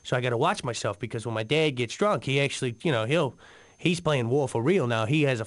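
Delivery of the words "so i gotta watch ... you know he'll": ordinary delivery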